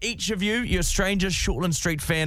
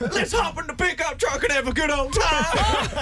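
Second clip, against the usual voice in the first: high, slow hollered American cowboy drawl; shouted mock-American accent, stretched out; 10 semitones higher, much slower than usual. Shown as a demonstration. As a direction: ham it up as a whooping American cowboy. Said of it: "American greeting someone is all".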